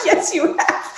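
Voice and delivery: breathy laughing reply; laughing agreement. Retold or imitated. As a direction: laugh it out as the amused therapist. Therapist's reply quoted, laughing.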